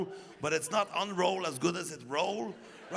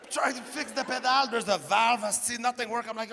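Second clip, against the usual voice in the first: normal talking voice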